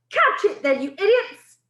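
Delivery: a witch screaming at her coven. Acted. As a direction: scream at your useless witches. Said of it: "screamed the Grand High Witch".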